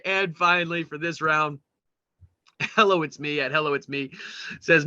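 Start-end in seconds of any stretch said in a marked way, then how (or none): none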